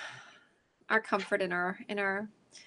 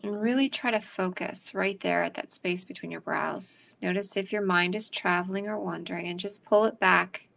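calm, unhurried breathing guidance; guided meditation; 3 semitones lower, 5 dB quieter than usual. Acted. Leads a meditation.

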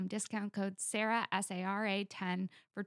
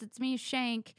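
ordinary delivery

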